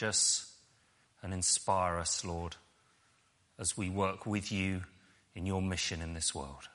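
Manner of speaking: soft, low prayer voice. Real, not acted. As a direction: pray quietly, pausing between phrases. Leads a prayer.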